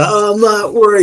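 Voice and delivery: high, loud, gushing fanboy; starstruck fan. Acted. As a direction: gush over your hero, voice shooting up. Fan NPC bowing.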